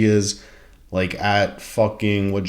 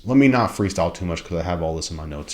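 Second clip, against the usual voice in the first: normal talking voice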